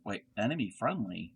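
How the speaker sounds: normal talking voice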